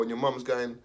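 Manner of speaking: usual speaking voice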